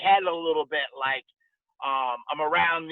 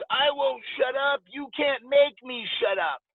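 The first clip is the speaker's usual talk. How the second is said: higher, mocking drunk defiance; defiant drunk; 7 semitones higher than usual. Drunk heckler refusing the coach's order, high childish defiance. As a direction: taunt the coach like a stubborn drunk. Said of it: "And the guy goes".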